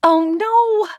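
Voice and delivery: high, sudden "Oh no!"; alarmed cry. A narrator's reading. Narrator crying out as water spills on Zane. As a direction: cry out as it tips. Bowl flips onto Zane.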